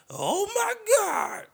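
very high, loud, slow crying falsetto; shrieking falsetto wail; Laughing Lenny impression. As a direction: wail it like a squealing comedian. Mimicking the comic's catchphrase.

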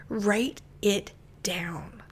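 slow, hushed whisper. Real, not acted. Speaker urging listeners to write ideas, slow whisper. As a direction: lean in and breathe the words slowly.

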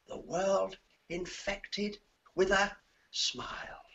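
slow whispered last line; soft ending. Acted. Ending the poem to the host in a slow whisper. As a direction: let the final line fade out. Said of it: "poem ending".

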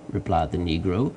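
plain reading voice

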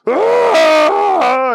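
long, loud, high scream; exasperated yell. A narrator's reading. Bunny driven mad by Booger's question, long loud scream. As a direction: scream out all your frustration. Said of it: "yelled the Easter Bunny".

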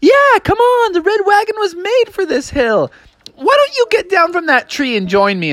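high, eager cartoon cub; high bear cub voice. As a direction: coax her down in a squeaky cub voice. Brother bear calls sister down.

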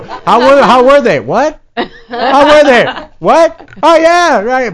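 high, loud, baffled fan voice. Retold or imitated. Mimicking fans baffled after a loud gig, high and loud. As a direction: mimic a confused fan asking how the band was. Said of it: "and people would go".

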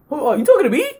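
high-pitched incredulous retort; mock surprise. Retelling his reply to an angry fan, mock surprised. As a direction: act out being caught off guard, voice jumping up.

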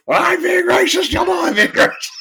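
high, put-on character voice; high-pitched comic TV impression; Pierce. Quoting a Community flashback scene, high impression. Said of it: "And Pierce is like".

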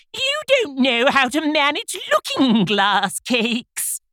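slow, very high creature voice; haughty unicorn. A narrator's reading. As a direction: instruct someone loftily in a high voice.

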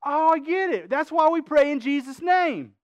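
high, loud mock "now I understand"; mock realization. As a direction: imitate a listener having a eureka moment. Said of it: "you're going".

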